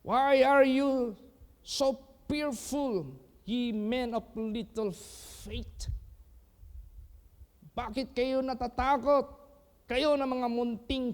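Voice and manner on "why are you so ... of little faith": high, slow, solemn rebuke; Jesus rebuking. Retold or imitated. Jesus rebukes the fearful disciples, high and slow. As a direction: draw it out like a gentle reproach. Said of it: "Anong sabi ni Jesus Christ?".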